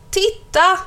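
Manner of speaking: put-on high excited shout of a child; children calling out. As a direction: yell it like a kid spotting something shocking. Schoolchildren shouting again.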